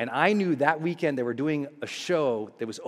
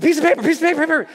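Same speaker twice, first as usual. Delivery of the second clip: much higher, louder, urgent repeats; frantic; 12 semitones higher, 5 dB louder, much faster than usual. Retold or imitated. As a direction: rush it out in a panic. Frantic request at front desk.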